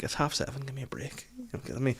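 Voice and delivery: half-asleep dad whispering to his daughter; sleepy whisper. Retold or imitated. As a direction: whisper it like you've just been woken. Groggy dad answering daughter.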